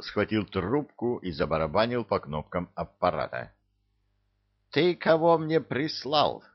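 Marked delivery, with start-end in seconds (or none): 4.7-6.4 higher-pitched irate bark; angry outburst from the boss; barked demand. A narrator's reading. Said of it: "рявкнул дьявол".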